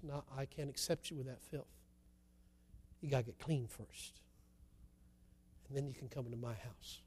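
very soft, low, solemn voice; quiet refusal. Acted. Father turns away filthy child, acted hushed firmness. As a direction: refuse gently but firmly, almost under your breath.

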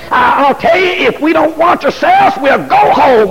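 high, shouted preaching; full-force preaching. Acted. Preacher shouting to congregation about careless attitudes. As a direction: lift the room with rising fervour.

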